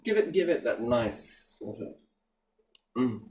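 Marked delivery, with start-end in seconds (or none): none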